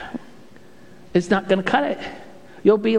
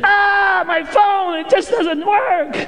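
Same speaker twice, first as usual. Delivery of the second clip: high, loud frustrated cry from the kitchen; wife's frustrated call; 14 semitones higher, 4 dB louder, much slower than usual. Retold or imitated. Wife calling from kitchen about dead phone, high frustrated wail.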